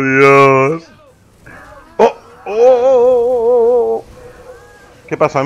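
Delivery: usual speaking voice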